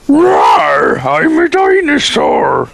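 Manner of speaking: high roaring dinosaur shout. Acted. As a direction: roar it like a hammy dinosaur. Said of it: "I can do some dinosaur voices".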